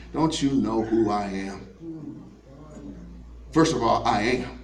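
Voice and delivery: very low, soft and deliberate; quiet authority. Acted. Jesus answering grieving Martha who he is, quiet authority. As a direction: state it with hushed, unhurried certainty.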